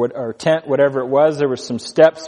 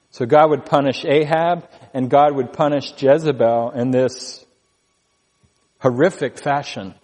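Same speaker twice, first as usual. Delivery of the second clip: ordinary talk, nothing marked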